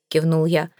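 ordinary delivery, nothing marked

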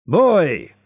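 high, loud summons; loud call for the waiter; shout. A narrator's reading. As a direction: bark for the serving boy. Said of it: "shouted Danglars".

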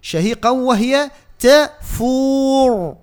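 slow chanted تفور, long "uu" into a thick r; sustained تفور ending on a full, heavy r; heavy final ra. Shown as a demonstration. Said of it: "عندنا الرام هنا مفخمة".

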